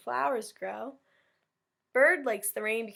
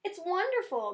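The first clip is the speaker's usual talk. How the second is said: much higher bright chirp; cheeping Bird; 9 semitones higher than usual. Acted. Teacher voicing Bird cheeping to the class, much higher. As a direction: cheep it happily. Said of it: "Bird cheeped".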